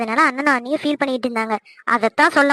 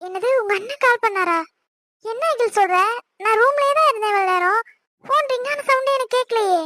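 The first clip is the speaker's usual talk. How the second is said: higher, startled and puzzled; alarmed surprise; 7 semitones higher than usual. Acted. Wife learning her husband called repeatedly, startled. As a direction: caught off guard, flustered.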